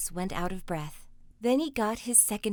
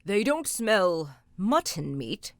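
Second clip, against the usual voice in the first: ogre growl; 4 dB louder, slower than usual. Acted. Ogre sniffing the room for Jack, slow growl. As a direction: growl suspiciously. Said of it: "he growled".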